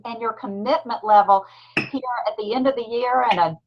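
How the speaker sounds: ordinary talk, nothing marked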